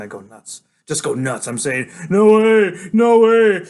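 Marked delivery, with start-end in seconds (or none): 2.1-3.6 loud, high wail of refusal; high, drawn-out shout of disbelief; frantic denial. A narrator's reading. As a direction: yell it out, refusing to believe. Narrator's character goes nuts.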